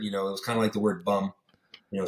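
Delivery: normal talking voice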